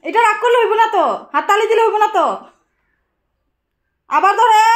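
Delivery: very loud, high-pitched telling-off; loud scolding. Real, not acted. Mother telling child not to touch things, loud scolding.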